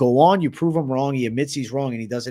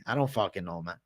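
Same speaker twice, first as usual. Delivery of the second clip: normal talking voice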